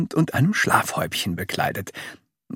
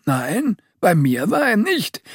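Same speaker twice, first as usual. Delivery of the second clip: high father voice; 8 semitones higher than usual. A narrator's reading. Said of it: "sagte Papa Dick".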